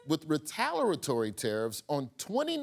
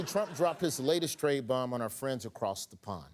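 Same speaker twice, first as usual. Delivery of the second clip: usual speaking voice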